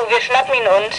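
normal talking voice

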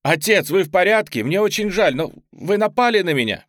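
high, loud, urgent apology; alarmed. A narrator's reading. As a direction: call out to the wounded man in alarm. Ли подбегает к раненому отцу.

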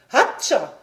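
loud mock sneeze; acted sneeze. A narrator's reading. Frog queen sneezes mid-dance, acted sneeze. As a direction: sneeze out a spoken "atchoum". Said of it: "Voilà que j'éternue".

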